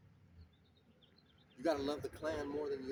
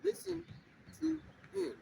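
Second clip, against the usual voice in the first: slow, raised reading voice, solemn; scripture reading; 12 semitones higher, slower than usual. Acted. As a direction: read the verse out to the brothers like a sermon. Reads scripture aloud.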